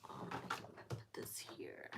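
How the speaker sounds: whispered aside to self; barely voiced, as you arrange things. Real, not acted. Setting cards aside.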